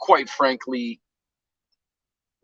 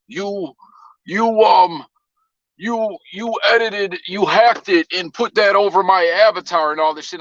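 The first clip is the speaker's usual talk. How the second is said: higher, halting mock accusation; stammering troll; 8 semitones higher than usual. Host imitating the troll accusing him of editing, stammering. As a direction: stumble over the words like a flustered liar.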